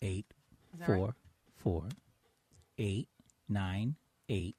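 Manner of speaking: very slow, long pause between digits. Shown as a demonstration. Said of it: "Can you say it even slower?".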